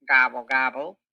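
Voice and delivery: a low grumbled gobble, gobble; grumbling gobble. Acted. Turkey sulking after Cow sees through him, low grumble. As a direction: mutter it like a sulky turkey. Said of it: "grumbled Turkey".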